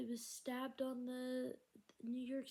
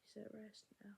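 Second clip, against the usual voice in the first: whispered; 10 dB quieter than usual. Real, not acted. Quiet grief over a death.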